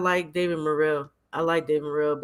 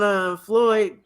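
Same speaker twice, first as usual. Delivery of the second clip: ordinary delivery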